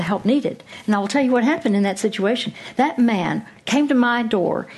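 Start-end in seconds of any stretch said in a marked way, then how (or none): none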